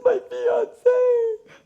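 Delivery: high, lightly tearful mock voice; imitating a crying woman. Imitating the sobbing young woman answering the psychic, mild mock crying. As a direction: squeak it out like a bawling young woman. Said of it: "and she says".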